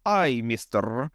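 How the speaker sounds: slow put-on German accent; hammed-up German accent, drawn out. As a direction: play a German newcomer greeting a shopkeeper. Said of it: "I'm the German guy".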